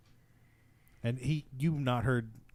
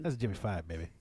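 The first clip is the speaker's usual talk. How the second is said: lightly voiced slick street hustler; a hint of hustler swagger; 4 semitones lower, 4 dB quieter than usual. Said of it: "That was a good little hustler voice".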